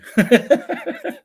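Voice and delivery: high, bursting laugh at her own punchline; sudden high cackle; loud laugh. Real, not acted.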